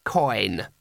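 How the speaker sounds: low, understated one-word reply; terse boy's answer. Acted. Boy gives the wizard his name, low and terse. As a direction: give the name low and unimpressed.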